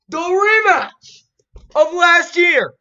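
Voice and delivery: high, loud, drawn-out hype call; hyped announcement. Real, not acted. Vlogger announcing a big playoff rematch, hyped shout. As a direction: sell the rematch like a ring announcer.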